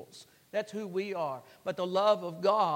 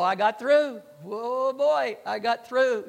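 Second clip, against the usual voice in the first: put-on louder, elated boyhood re-enactment; retold boyish triumph; 2 semitones higher, 6 dB louder, slower than usual. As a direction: crow with relief, stretching the exclamation. Acts out boyhood triumph at passing.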